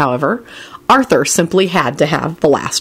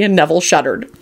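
plain reading voice

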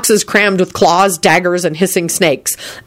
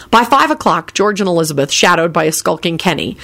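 plain reading voice

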